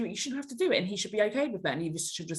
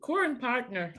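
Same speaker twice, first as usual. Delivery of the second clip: put-on higher echo of an Irish accent; mimicked Irish accent; 6 semitones higher, slower than usual. Guest copies the host's words with delight, imitated Irish accent. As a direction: parrot the phrase back in Irish. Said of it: "I love that".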